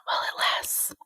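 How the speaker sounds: breathy ominous whisper; spooky whisper. Pretend. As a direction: whisper it like a dark omen.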